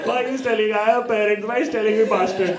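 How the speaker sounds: high-pitched mock wail; hammed-up childish crying complaint. Retelling how they cried as a child over an insult, mock crying. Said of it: "So I started crying, dude".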